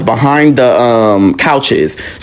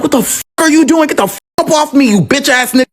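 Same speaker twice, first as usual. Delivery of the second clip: retold flip-out; 13 semitones higher than usual. Nephew flipping out at uncle who touched him, high outraged protest. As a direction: reenact a furious protest, voice pitched way up. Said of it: "I immediately flip the fuck out".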